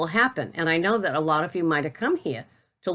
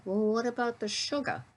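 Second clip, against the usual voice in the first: higher, softer mock question; mimicking worried commenters; 5 semitones higher, 6 dB quieter than usual. Mimicking viewers who ask about sugar, mock-concerned. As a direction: put on a small, high voice for the doubters.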